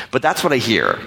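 ordinary talk

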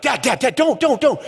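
panicked son begging dad to calm down; frantic pleading. Retold or imitated. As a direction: plead with him to stop, voice jumping up.

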